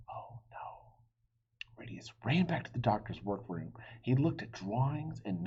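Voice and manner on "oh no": whispered dismay. Acted.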